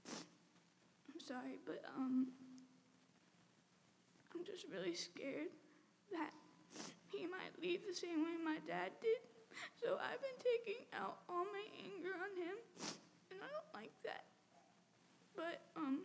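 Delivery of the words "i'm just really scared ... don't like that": real tearful voice, rising high; choked-up, higher, breaking into sobs; crying. Real, not acted. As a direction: speak through real tears. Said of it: "It's embarrassing crying in front of people".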